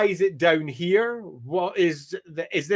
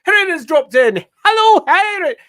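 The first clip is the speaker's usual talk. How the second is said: bright, much higher, loud hello; excited greeting; 11 semitones higher, 11 dB louder than usual. Real, not acted. Streamer greets a viewer who just joined, excitedly. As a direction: greet the newcomer with a big lift.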